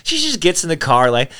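usual speaking voice

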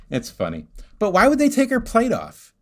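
ordinary talk, nothing marked

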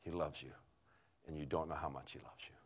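low, hushed and earnest; suddenly serious. Retold or imitated. Lady gently correcting the man, quiet and serious. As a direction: drop to a soft, sincere tone. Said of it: "she gets serious again".

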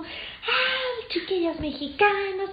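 a high-pitched, hammed-up scolding; landlady scolding the Mexican girls, lightly mocked; mock angry landlady. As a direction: imitate the angry landlady, mocking not shouting. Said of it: "ella estaba enojada".

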